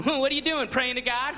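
mock judgmental. Mocking churchgoer judging.

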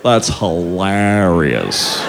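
low, very slow, lazy "hilarious"; slack bro drawl. Retold or imitated. Imitating the guy reacting to the news, slow and low. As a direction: drag "That's hilarious" out flat and lazy. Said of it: "And he goes".

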